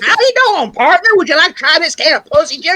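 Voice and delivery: high mock cowboy hawker; old West salesman. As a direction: imitate a friend's old West sales pitch. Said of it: "taking it to the old West".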